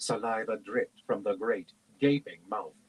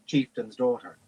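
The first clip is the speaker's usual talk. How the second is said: ordinary delivery, nothing marked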